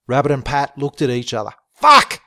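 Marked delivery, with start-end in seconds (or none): 1.7-2.2 high, loud burst of alarm; alarmed shout. Acted. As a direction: blurt the curse in sudden alarm. Both men panic at radio news.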